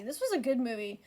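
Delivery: ordinary delivery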